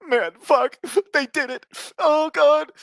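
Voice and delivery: very high hammed-up wail of a weepy fan; mock crying fan. Mocking a fan who cries at a Flash clip, high hammed-up wail. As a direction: overdo the sobbing fan, way up high. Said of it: "I'm not gonna sit here and be like".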